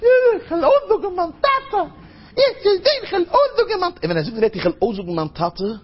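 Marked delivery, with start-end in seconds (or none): 0.0-3.9 put-on very high-pitched little child squealing about Daddy; child's voice. Kid threatening to tell his father, child's voice. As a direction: pipe up like an excited small kid. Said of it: "wie ein Kind sagt".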